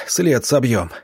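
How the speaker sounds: usual speaking voice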